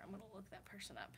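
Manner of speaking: softly whispered to herself while typing; hushed whisper, fast; whispered aside. Real, not acted. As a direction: breathe it out as a private note.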